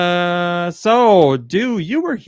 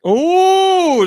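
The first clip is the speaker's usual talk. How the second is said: impressed ooh; 7 dB louder than usual. Real, not acted. Impressed by friend's joke.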